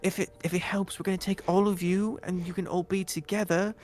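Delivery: guard reassuring a scared prisoner, sweetly high; doting guard. Acted. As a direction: soothe the captive sweetly. Guards voiced comforting.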